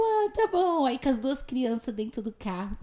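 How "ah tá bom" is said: very high-pitched little reply; mock-meek agreement. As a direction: agree in a much higher voice. Acts out her own timid agreement. (concordando com voz bem aguda)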